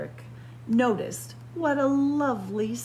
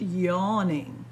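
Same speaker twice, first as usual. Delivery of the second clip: ordinary delivery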